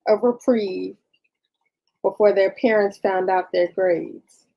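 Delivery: halting dictation, speaking the punctuation aloud; dictating. Real, not acted. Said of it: "hear me dictating".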